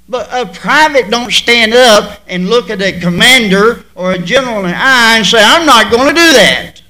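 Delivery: loud, fast build to a defiant refusal; rising preacher shout; forceful preaching. Real, not acted. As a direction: hammer it home from the pulpit.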